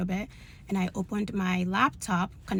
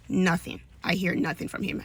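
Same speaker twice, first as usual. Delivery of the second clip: ordinary delivery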